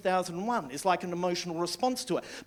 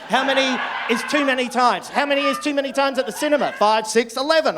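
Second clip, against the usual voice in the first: higher and louder, fast on the repeat; loud comic rant; shouted rant; 6 semitones higher, 7 dB louder than usual. Real, not acted. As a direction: yell the question at the crowd. Rising rant about viewings.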